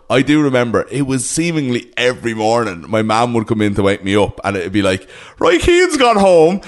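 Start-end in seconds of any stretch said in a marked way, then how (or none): 5.4-6.6 put-on high, slow old country woman voice; a mother from the country drawling the news; mum's country voice. Mum waking her son with the news, high and slow. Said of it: "my mother doesn't speak like that".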